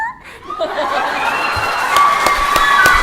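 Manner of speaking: high squealing giggle of delight; excited squealing laugh. Real, not acted. Fan recalling hugging the author, high squealing laugh. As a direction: let out a giddy squeal of a laugh. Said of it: "I was so excited!".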